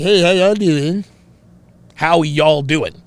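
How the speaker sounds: foreign English with a Southern drawl; Doctor Now. Retold or imitated. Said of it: "they've got that Southern drawl".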